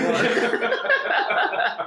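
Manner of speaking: slow laugh through words; drawn-out laughing. Real, not acted. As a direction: stretch it while laughing. Joking about polishing.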